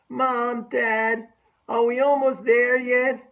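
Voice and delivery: mock whining kid asking are we there yet; whiny child. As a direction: pitch up and nag like a kid in the back seat. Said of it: "you sound like a child".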